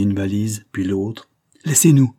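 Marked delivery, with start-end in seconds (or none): 1.6-2.1 sharp raised cry; shouting. A narrator's reading. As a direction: order him out loudly. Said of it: "crie Liane".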